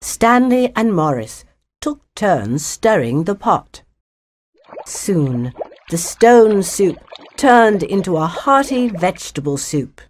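ordinary delivery

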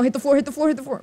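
high, rapid urging; urgent pleading. Real, not acted. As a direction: urge the ball down in a panic. Urging the ball down in game.

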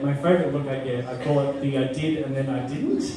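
ordinary delivery

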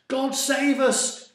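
high, loud, stretched-out plea; crying out. A narrator's reading. Another sailor in the storm, crying out. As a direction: cry to heaven at full voice. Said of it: "cried another".

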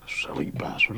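unvoiced whispered muttering; whispered insult. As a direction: say it under your breath.

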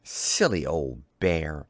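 low, slow and fond; tender murmur to oneself; affectionate, unhurried closing line; loving. A narrator's reading. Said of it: "looked after him lovingly and said to himself".

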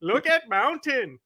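slow, hammed-up Indian accent; mock sales pitch in a drawn-out Indian accent. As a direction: stretch it out in a put-on Indian accent. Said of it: "doing an Indian stereotype accent".

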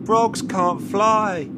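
high, loud, slow frantic pleas; panicked cry. A narrator's reading. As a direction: yell in falling terror. Pop falling, panicking.

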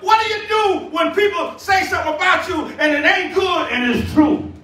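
ringing challenge from the preacher; impassioned preaching. Real, not acted. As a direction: press the question on the congregation. Preached question, then repeated low.

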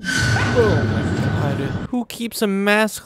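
slow, stunned oh my god; drawn out in disbelief at the mask; shocked gasp. Real, not acted. Player startled by a creepy locker mask, stunned.

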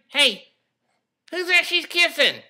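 high squeaky puppet voice; dummy voice. Acted. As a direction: voice the little dummy.

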